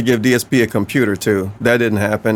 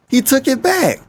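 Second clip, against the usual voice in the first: incredulous, much higher repeat; 13 semitones higher than usual. Real, not acted. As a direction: squeak it in disbelief.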